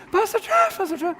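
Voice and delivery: high, gushing calls; excited repeated greeting. Retold or imitated. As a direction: sing out his name in delight.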